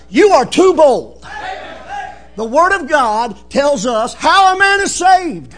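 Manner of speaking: loud, emphatic pulpit challenge; forceful preaching. Real, not acted. Preacher warning those who preach against believing, loud and forceful.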